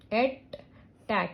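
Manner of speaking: "attack" as at, pause, tack; split into syllables. Shown as a demonstration. Teacher showing a two syllable word, split into syllables. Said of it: "this is two syllable word".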